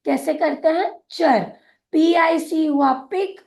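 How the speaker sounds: ordinary talk, nothing marked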